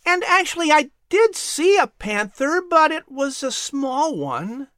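put-on high boy's voice, teasing with a grin; grinning boy. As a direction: slyly drop the news. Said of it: "added with a grin".

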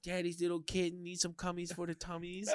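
put-on high cutesy baby voice, teasing the cooks; baby talk. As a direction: coo it like talking to a kitten. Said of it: "I'll be like".

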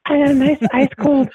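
put-on high, quavering old Southern lady voice; very high old lady voice, warm and inviting; old antebellum lady. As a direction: play a sweet old Southern grandma calling for her lawn boy. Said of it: "this actually sounds like an old antebellum lady".

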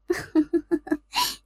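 high, light giggle; mocking laugh. Lady answers the captive's outburst, mocking laugh. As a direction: laugh off her anxious questions.

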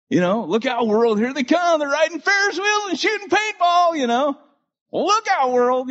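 a mocking herald's cry; mock hype. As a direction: send up the hype of the next generation. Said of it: "I remember walking around going".

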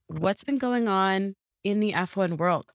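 ordinary talk

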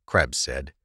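ordinary delivery, nothing marked

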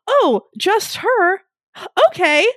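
slow, high mock-hurt princess voice; mock offended princess. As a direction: pretend to be wounded.

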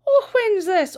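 high, loud eager gossip; nosy gossip voice. Retold or imitated. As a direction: gasp out the question. Missus Mackenzie eager.

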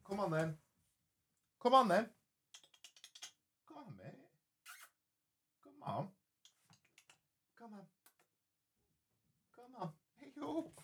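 high, soft coaxing voice for a cat. Real, not acted. Host coaxing his cat through the door, high and soft. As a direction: coo gently to tempt the cat.

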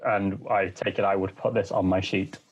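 usual speaking voice

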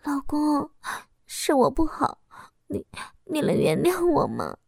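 soft, gentle plea with small hesitations; tender. A narrator's reading. Wife nestled against her husband, pleading tenderly. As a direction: ask forgiveness softly and tenderly. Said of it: "温柔地说道".

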